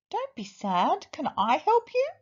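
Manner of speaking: small, high mouse voice; little voice. A narrator's reading. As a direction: offer help in a tiny high voice. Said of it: "a little voice said".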